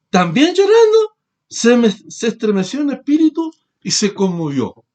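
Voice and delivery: lifted preacher's reading voice; scripture reading. Acted. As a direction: read the passage out to the congregation. Reads scripture aloud to group.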